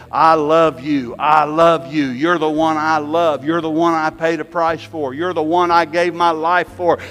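building from tender to loud and high; tender divine love. Acted. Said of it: "he's still in there saying".

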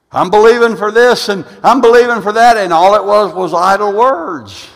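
high, loud challenge to the congregation; forceful preaching. Real, not acted. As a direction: proclaim it emphatically, driving the correction home.

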